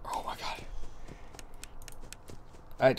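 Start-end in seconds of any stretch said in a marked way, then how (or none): 0.0-0.6 scared whisper. Real, not acted. Hiding from a jump scare.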